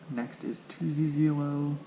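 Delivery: usual speaking voice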